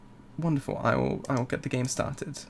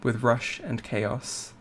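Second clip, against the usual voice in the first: poem reading; 4 dB quieter than usual. Acted. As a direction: read it like a tender poem. Reads the poem in game.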